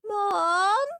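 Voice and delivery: high, long wail for mum. A narrator's reading. Peter calling mum for help, wailed. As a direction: wail like a tattling little brother. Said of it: "wailed Peter".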